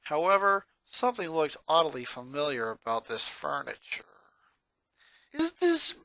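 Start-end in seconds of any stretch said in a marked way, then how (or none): 5.3-6.0 high, rising disbelief that breaks into a cry; high, shocked boy voice cracking on "bed". Acted. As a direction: stumble over the word as it dawns on him. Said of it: "he said in shock".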